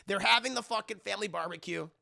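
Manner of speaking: ordinary talk, nothing marked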